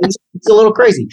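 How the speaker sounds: rapid, high, excited mock Scorsese; Scorsese impression. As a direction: rattle it off fast and high like the director. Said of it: "he was kinda like".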